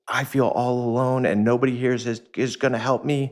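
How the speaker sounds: high, forlorn inner voice; lonely plea. Retold or imitated. Angry man voicing his inner fear, lonely and high. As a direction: voice the hurt child inside, pleading. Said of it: "what is actually happening is".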